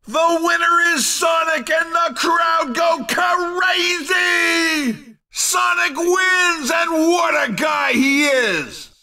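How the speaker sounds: high, drawn-out victory cry; ecstatic winner call. Acted. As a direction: stretch the big words in wild celebration. Announcer calls the crowd favourite winning.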